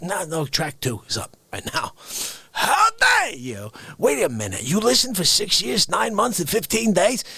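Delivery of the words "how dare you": slow, high shouted how dare you; hammed-up indignant shout; mock outrage.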